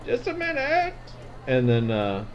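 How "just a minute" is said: voice behind the door. Acted. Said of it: "you say".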